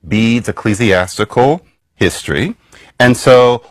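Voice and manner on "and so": ordinary delivery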